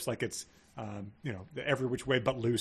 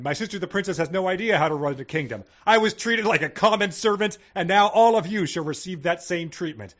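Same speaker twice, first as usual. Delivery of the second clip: raised, louder mock rant; a hammed-up royal tantrum, louder; mock yelling prince; 4 semitones higher, 7 dB louder than usual. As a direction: rant at them like a wronged prince. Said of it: "that was all yelled".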